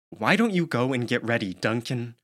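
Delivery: ordinary delivery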